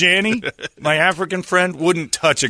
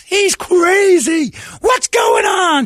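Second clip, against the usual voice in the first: high, slow shrieking protest; screaming denial; 8 dB louder, much slower than usual. Retold or imitated. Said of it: "he's gonna start screaming".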